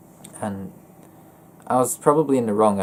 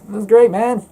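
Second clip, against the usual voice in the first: high, loud bright cheer; overly upbeat; 13 semitones higher, 10 dB louder, slower than usual. Retold or imitated. Mock reply to chat.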